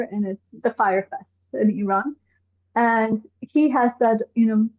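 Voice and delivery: ordinary delivery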